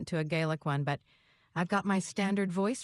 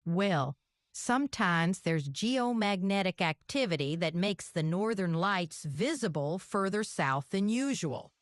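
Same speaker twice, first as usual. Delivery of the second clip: Southern twang; hillbilly accent. Pretend. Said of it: "You're a hillbilly girl".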